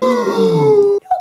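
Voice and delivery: very high gasp that tips into laughter; shocked gasp. Retold or imitated. Friends see the lunch pail spill open, loud shocked gasp. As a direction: gasp as if you had found something horrible. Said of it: "me and Tim went like".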